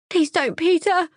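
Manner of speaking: high, loud forlorn plea; high tearful pleading; desolate bleat. Acted. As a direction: bleat it out hopelessly. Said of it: "she bleats desolately".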